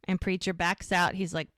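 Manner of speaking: ordinary talk, nothing marked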